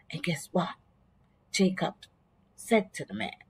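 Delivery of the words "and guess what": whispered, hushed teaser; suspense whisper. Real, not acted. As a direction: lean in and whisper it. Storyteller builds suspense.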